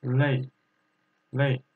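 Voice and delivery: 累 said with a sharp falling fourth tone; tone drill. Shown as a demonstration. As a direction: drop the pitch hard on 累. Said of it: "Fourth tone".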